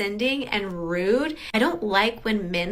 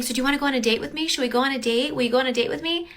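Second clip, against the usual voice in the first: fast, eager date questions; rushed pushy asking; 3 semitones higher, much faster than usual. Retold or imitated. [name] mimics the guy pestering her for a date, rushed and pushy. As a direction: rattle off the questions. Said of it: "Then he kept saying".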